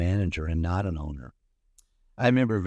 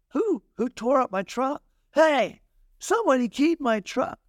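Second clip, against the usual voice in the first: high, pitched-up angry shout; irate yelling. Retold or imitated. Truck owner shouting to nobody about his keyed truck, high angry yell. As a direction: yell it out like a man whose new truck got keyed. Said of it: "that man began to go irate".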